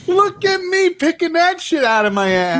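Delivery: "Look at me" thrown up high, stretched out; hammy acting. Teasing a co-host, in a mock dramatic voice. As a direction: overact it like a ham actor.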